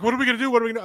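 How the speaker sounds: high, loud mock panic; freaking-out wife. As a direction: imitate a panicked wife repeating herself. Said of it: "She starts freaking out".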